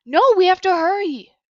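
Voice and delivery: eager, high and loud insisting; insistent. A narrator's reading. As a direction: push them to hurry up. Claire insisting, rushing.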